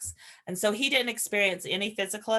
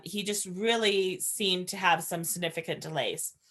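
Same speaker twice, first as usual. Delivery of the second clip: usual speaking voice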